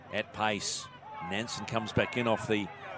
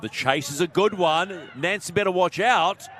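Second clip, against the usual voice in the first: excited commentary; 7 semitones higher, 7 dB louder than usual. Real, not acted.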